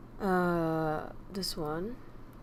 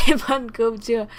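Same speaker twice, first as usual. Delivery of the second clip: laughing; 4 semitones higher, 11 dB louder than usual. Shown as a demonstration. Laughing loud phrase demo.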